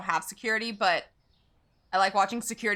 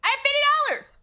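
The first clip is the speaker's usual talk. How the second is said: excited shout; 6 dB louder, much faster than usual. Real, not acted. Excited over game money.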